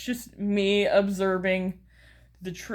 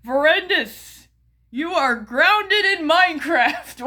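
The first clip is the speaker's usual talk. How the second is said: higher, drawn-out name, then mock verdict; mock scolding; 7 semitones higher, 4 dB louder than usual. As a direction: playfully hand down a punishment as YouTube. Imagined YouTube scolding the creator.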